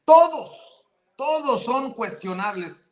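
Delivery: high, loud mocking "todos"; sarcastic mock quote. Commentator mimics the court president after her clip, mocking. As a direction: blurt it high in mock indignation, easing off.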